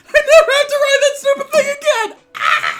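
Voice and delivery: very high, loud hammed-up glee; hysterical laughing. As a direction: squeal it through mock hysterics. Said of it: "start laughing hysterically".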